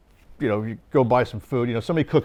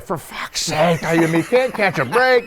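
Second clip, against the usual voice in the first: exasperated; 4 semitones higher, 3 dB louder than usual. Retold or imitated. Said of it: "he's like".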